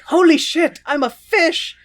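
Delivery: high, loud astonished cry; shocked yelp. Retold or imitated. Werther discovering he is a fish, loud high shock. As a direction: blurt it out in shock.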